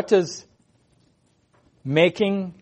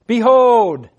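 a loud, raised call of behold; proclaimed; 5 semitones higher, 6 dB louder than usual. Acted. Preacher voicing God from the throne, a loud call. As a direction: announce it like a herald calling for attention.

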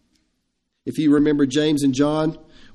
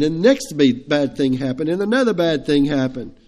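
ordinary delivery

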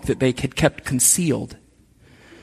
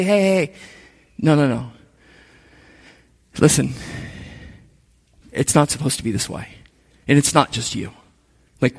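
soft, lower reassuring friend; gentle comfort; 5 semitones lower, 5 dB quieter than usual. Pretend. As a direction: ease them down gently, like a friend. Friend comforting a struggling believer.

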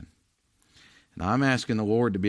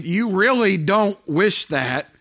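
skeptical retort; 9 semitones higher, 7 dB louder, slower than usual. Retold or imitated. Inner retort to the other preacher.

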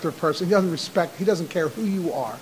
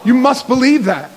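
shouted preaching, much higher and louder; fiery revival shout; preaching at full force; 11 semitones higher, 8 dB louder than usual. Acted. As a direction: build to a roaring climax of faith.

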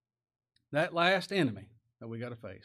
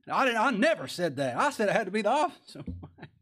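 high, loud protest breaking into a laugh; hammed-up outraged backtracking; mock indignant denial; 8 semitones higher, 7 dB louder than usual. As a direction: deny it hotly, playing it for laughs. Acted denial in retold quarrel.

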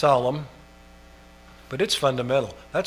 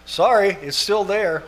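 ordinary delivery

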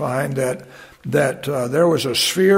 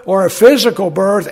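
ordinary delivery

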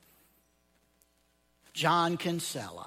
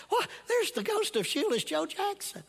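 amazed Ray. Acted. Ray marvels at the ghost.

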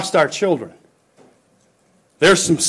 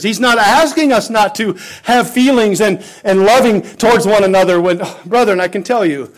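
higher, emphatic pulpit delivery; fervent preaching; 7 semitones higher, 7 dB louder than usual. Acted. Preacher reminding the brethren that Jesus wept, fervent preaching. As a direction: drive each line home to the pews.

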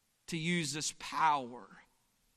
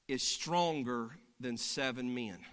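normal talking voice

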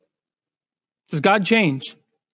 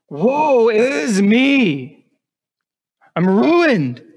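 slow, drawn-out, louder cry of woe; anguished lament; 5 semitones higher, 3 dB louder, much slower than usual. Acted. Isaiah crying out in despair before God. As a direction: wail it as a man undone.

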